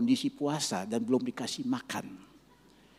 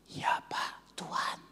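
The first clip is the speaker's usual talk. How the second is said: soft hushed answer; whispered reply; 3 dB quieter, much slower than usual. Retold or imitated. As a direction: whisper back in awe. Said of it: "saya juga ikut suaranya".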